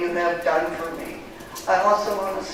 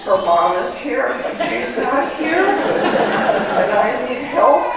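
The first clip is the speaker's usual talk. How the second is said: higher, slow and laughing at her own confusion; laughing disbelief; 6 semitones higher, 5 dB louder, slower than usual. Retold or imitated. Telling the church how confused she was, slow and laughing. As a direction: stretch it out through a laugh, baffled.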